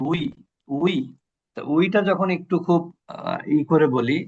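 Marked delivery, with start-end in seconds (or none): 0.1-0.9 উই like English we, lips rounded, no b or v; English we sound. Shown as a demonstration. Teacher showing students the উই sound. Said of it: "ইংরেজি যেমন আমরা উই বলি".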